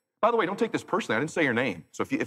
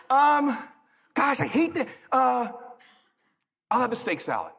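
flustered wife voice; 7 semitones higher than usual. Retold or imitated. Wife ordering.

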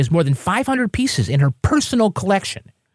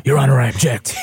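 husky prosecutor voice; 4 semitones lower, faster than usual. Retold or imitated. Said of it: "Sounds like the husky A D A voice".

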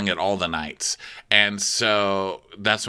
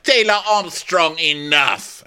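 mock stern yell; 8 semitones higher, 3 dB louder, slower than usual. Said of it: "the king's like".

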